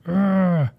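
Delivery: real drawn-out "ugh" of annoyance; frustrated groan. Real, not acted. Teacher forgets the password mid-demo, frustrated groan. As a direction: groan at your own forgetfulness.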